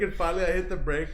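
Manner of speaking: usual speaking voice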